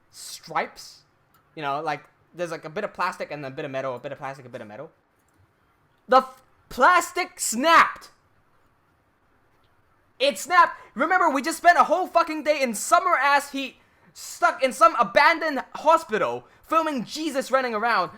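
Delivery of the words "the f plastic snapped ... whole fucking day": louder and higher, slowly drawn out; exasperated outburst. Real, not acted. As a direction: blurt out the disaster, stretched and loud. Disbelief at the broken card.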